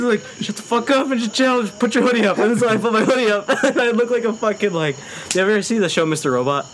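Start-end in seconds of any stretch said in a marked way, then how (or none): 0.4-2.4 high, hammed-up urgent order; retold urgent scolding. Friend telling him to chill near cops, mock scolding.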